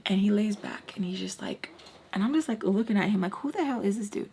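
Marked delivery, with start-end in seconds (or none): none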